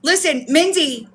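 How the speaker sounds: normal talking voice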